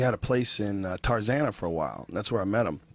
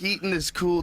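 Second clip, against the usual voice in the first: ordinary delivery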